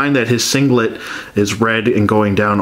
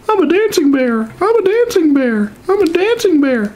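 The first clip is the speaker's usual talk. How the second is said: silly high voice. Pretend. Voices the figure dancing.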